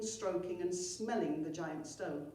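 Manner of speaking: plain reading voice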